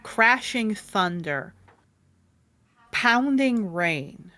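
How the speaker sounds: slow, suspenseful narration with long pauses; dramatic scene setting. A narrator's reading. Game master wakes players to a massive storm, slow and suspenseful. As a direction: draw out each image for suspense.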